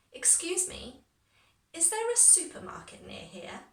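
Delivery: high-pitched, careful repeat-after-me delivery. Shown as a demonstration. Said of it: "I'll start a little bit slower".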